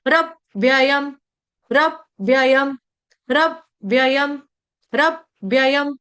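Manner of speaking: slow रव्ययम as rav-vyayam with half व; short break after the v in रव्ययम; correct pronunciation drill. Shown as a demonstration. Teacher repeating the right form, slow split pronunciation. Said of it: "ये गलत उच्चारण हो जाएगा".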